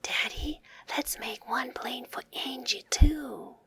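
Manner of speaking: breathy secret whisper in dad's ear. A narrator's reading. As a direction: whisper it close to his ear. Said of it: "Max whispered in Daddy's ear".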